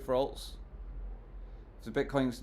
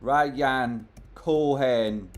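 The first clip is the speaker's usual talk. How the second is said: Ryan Cohen, very slow and louder; drawn-out name; 6 dB louder, much slower than usual. Real, not acted. Streamer announces the CEO's tweet: slow, drawn out. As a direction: stretch every syllable for effect.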